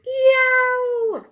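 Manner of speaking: very high loud yow; jet roar. A narrator's reading. Teacher imitates a noisy jet, very high and loud. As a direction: blast it like a jet overhead. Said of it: "A jet goes".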